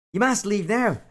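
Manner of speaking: high, dramatic hero voice; struggling attempt at a heroic voice; Rufang. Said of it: "I just can't do Rufung's voice".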